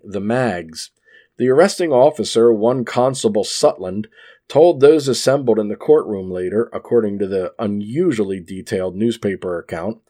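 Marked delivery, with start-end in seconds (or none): none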